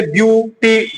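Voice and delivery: "ब्यूटी" slowly split as ब्यू-टी; syllable by syllable. Shown as a demonstration. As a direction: pull "ब्यूटी" apart into two syllables. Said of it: "दो या तीन syllable का use होता है". ("ब्यूटी" को धीरे-धीरे ब्यू-टी तोड़कर)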